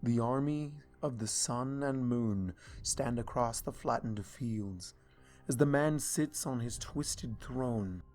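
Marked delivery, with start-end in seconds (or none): none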